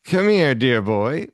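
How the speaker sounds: playful Vincent Price being fond; affectionate Vincent Price. Retold or imitated. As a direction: coo it like a mock-affectionate horror host.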